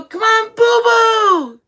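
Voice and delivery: high, loud cooing call to her son; cooing mom. Retold or imitated. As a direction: coo it across the gym like a doting mom. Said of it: "I go".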